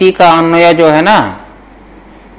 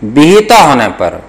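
slow, measured dictation to students; slower than usual. Shown as a demonstration. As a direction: spell out each word slowly for note-taking. (धीमे-धीमे श्रुतलेख लिखवाते हुए)

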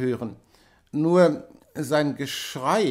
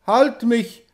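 higher, louder desperate cry; screaming; 7 semitones higher, 5 dB louder than usual. A narrator's reading. Feverish wife crying out to her husband, screaming. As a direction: cry out for him to hold her. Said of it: "schrie sie".

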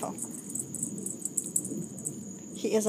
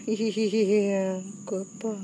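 usual speaking voice

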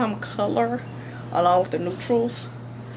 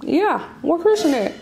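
calling out; 7 semitones higher than usual. Real, not acted. Calling child from another room.

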